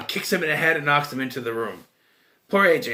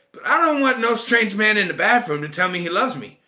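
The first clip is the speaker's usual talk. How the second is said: usual speaking voice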